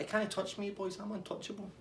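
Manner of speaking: high, sing-out mock taunt; cheeky. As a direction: imitate an untouchable chancer, high and unbothered. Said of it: "you need to stop being cheeky".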